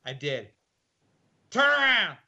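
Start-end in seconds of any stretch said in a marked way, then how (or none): 1.5-2.2 much higher, louder guard bark; barked order. Retold or imitated. Gruff guard barking at the inmate, loud and high. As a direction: snap the order at him. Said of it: "he barked".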